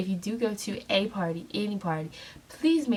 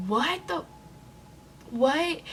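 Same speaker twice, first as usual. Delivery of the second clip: stunned disbelief; 9 semitones higher, much slower than usual. Retold or imitated. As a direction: react like you can't believe what you heard. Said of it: "And I'm just like".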